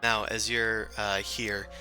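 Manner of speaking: ordinary talk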